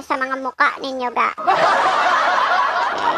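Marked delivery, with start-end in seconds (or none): 1.4-3.2 breathy laughing okay. Real, not acted.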